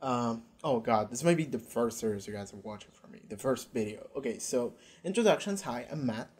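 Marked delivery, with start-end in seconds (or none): none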